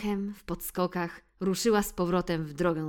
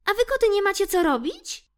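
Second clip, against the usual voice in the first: high, loud brave mouse voice scolding the cats; brave little mouse; 8 dB louder than usual. Acted. Mouse Ixi challenges the teasing cats, high and loud. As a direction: stand up to the cats as a tiny squeaky mouse. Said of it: "zapytała odważnie".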